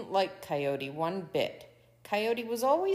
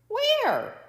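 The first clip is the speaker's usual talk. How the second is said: high, loud cry; exasperated coyote yelp; frantic searching; 8 dB louder than usual. A narrator's reading. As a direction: call it out way up high.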